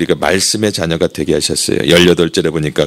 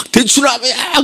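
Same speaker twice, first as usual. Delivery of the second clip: 아주 높게 내지르며 우기기; 술김에 싸우는 사람 흉내; 취중 말싸움; slower than usual. Retold or imitated. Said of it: "술 먹고 막". (우기며 외치는 말투)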